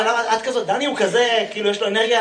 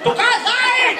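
high yelp; 12 semitones higher, slower than usual. Acted. Punchline yell.

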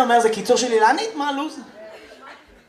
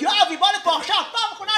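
high-pitched mock Israeli mother; אמא ישראלית; 9 semitones higher, much faster than usual. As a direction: imitate an exasperated mum warning her kid, pitched way up. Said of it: "כל האמהות הישראליות". (אמא ישראלית בקול גבוה)

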